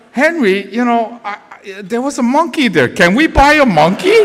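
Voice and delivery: high, eager wheedling; retold pleading wife. Wife asks her husband for a monkey, pleading. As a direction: imitate a wife coaxing for a treat.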